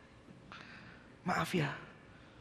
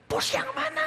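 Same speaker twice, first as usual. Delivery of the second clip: much higher, demanding boss voice; pressing boss. Retold or imitated. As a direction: push the question like an impatient boss. (bos mendesak dengan suara tinggi)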